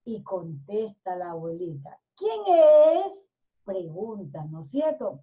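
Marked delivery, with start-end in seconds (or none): 2.2-3.2 high, loud calling question; called out. A narrator's reading. Grandmother answers the knock, calling out. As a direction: call through the door, rising at the end. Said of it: "Gritó la mujer".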